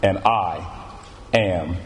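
measured, solemn Bible reading with weighted pauses; scripture reading. Acted. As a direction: read the verses out deliberately, phrase by phrase. Reads scripture aloud.

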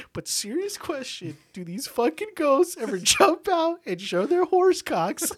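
high, slow, drawn-out tearful imitation; mock crying. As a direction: push through fake tears in a squeaky voice. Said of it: "He's, like, crying".

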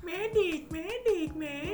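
calling out. Pretend. Calling for a medic jokingly.

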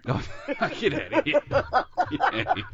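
high, laughing get outta here; playful disbelief. Acted. Teasing friend's modesty.

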